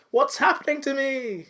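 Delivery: high-pitched damsel in distress; mock alarm. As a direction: cry out in hammed-up bewilderment. Voices MJ spinning helpless in vortex.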